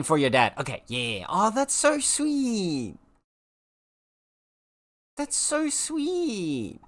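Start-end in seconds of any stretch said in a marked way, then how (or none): 1.3-6.8 high, slow drawn-out coo; high, stretched cooing "so sweet". Real, not acted. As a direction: melt over the sweet gift. Gushing about gift.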